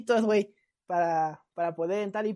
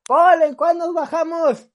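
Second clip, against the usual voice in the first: loud high call, slow; shouting across bus; 7 semitones higher, 10 dB louder, slower than usual. Retold or imitated. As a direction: yell over a packed bus to a friend. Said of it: "le grito a Paul". (grito por encima de la gente)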